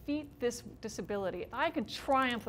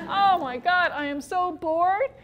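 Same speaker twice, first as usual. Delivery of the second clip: exaggerated boredom; 6 semitones higher, 9 dB louder, slower than usual. Real, not acted.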